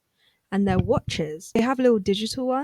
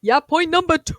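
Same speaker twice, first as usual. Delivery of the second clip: loud high hype cheer; big high cheer; catchphrase shout; 7 semitones higher, 8 dB louder than usual. Real, not acted. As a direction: shout the point number. Catchphrase chant with co-host.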